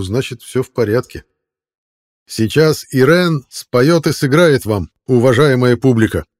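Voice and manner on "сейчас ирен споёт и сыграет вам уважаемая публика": loud, slow showman's announcement; booming announcement. A narrator's reading. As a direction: announce it grandly to the crowd. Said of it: "громогласно объявил администратор".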